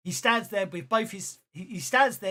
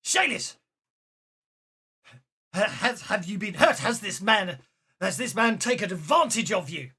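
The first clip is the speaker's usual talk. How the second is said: loud, alarmed demand; storming father; 2 semitones higher, 6 dB louder than usual. Acted. Father storming down to question his daughter, outraged. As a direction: call out with outraged urgency. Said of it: "And comes storming down".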